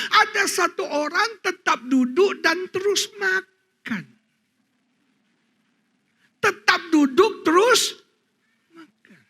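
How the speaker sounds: high-pitched excited preacher storytelling; fiery preaching. Acted. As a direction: preach it at full force to the congregation. Preaching at full force.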